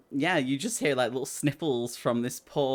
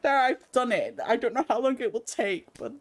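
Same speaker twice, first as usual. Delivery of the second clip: put-on a touch higher, childish tone; lightly voiced boy leader; 9 semitones higher than usual. Boy leader reports to party, lightly high. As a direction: hand it over smugly. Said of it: "he's like".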